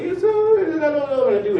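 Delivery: high teary whimper, retold; imitating himself crying on the phone; mock crying. Young man to his mother on the phone, high teary voice.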